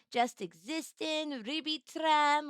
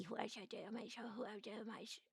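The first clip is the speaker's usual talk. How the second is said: much softer muttering; muttering to self; 3 semitones lower, 18 dB quieter, much faster than usual. Real, not acted. Scanning chat names, muttering to herself. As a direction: mutter it under the breath.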